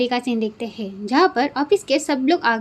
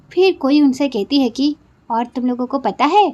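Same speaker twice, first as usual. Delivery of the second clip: ordinary delivery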